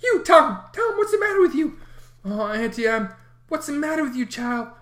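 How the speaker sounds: put-on high, loud, frightened old aunt; a scared aunt at the bedside; gasped. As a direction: gasp it out in fear. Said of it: "she gasped out".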